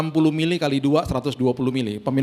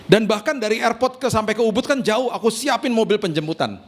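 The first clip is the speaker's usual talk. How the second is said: higher chatty woman's voice on a phone call; friend on the phone; 7 semitones higher than usual. Retold or imitated. Friend calling the wife to offer her villa, voiced higher and eager. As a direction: play the apologetic friend calling, pitched up. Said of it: "istri saya ditelepon sama temennya".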